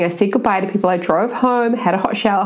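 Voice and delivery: usual speaking voice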